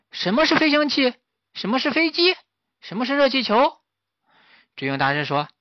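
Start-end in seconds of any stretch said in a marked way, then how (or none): 0.1-3.8 high, eager monkey voices; curious little monkeys piping up; high-pitched curious chorus; monkeys asking. A narrator's reading. Monkeys ask questions.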